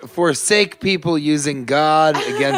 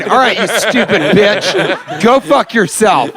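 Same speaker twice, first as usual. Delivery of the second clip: laughing mockery; 5 semitones higher, 3 dB louder, slower than usual. Mocking the caller.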